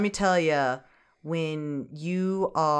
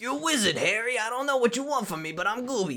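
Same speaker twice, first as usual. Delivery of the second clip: attempted Hagrid voice; 6 semitones higher, 3 dB quieter than usual. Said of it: "that fucking Hagrid voice".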